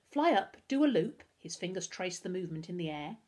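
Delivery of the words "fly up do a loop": plain reading voice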